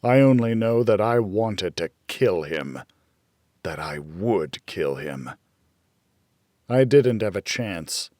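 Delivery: ordinary delivery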